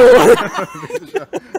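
high burst of laughter; bright laugh. Real, not acted. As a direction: laugh out loud at the joke. Reacts to the joke.

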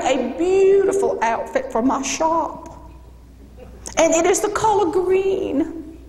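hammed-up Southern hostess, pitched high with long vowels; Southern belle accent. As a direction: announce the fashion show as a drawling Southern belle. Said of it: "the Southern belle with the accent".

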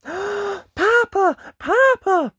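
high, loud excited shout; surprised cry. Acted. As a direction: cry out in joyful shock. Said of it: "he cried out in surprise".

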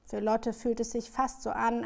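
ordinary delivery, nothing marked

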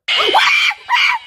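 sudden shrieking laugh of disbelief; a real shout of a laugh; loud high laugh. Real, not acted. Bursts out after confusion.

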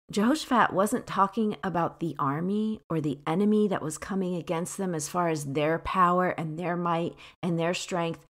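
ordinary delivery

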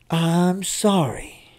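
slow, drawn-out soothing sorry; tender apology. Acted. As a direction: soothe the hurt child. Mother comes over to apologize.

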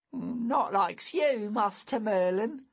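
put-on high boy voice, slowed; a shy admiring boy. A narrator's reading.

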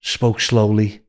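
usual speaking voice

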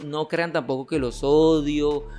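usual speaking voice

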